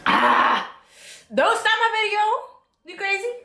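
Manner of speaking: ordinary talk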